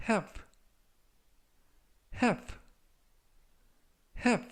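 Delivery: "have" said short and crisp as /hæv/; short, clear "have" with the vowel as in cat; pronunciation model. Shown as a demonstration. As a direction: clip "have" short for learners. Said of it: "라고 발음하시면 되겠고요".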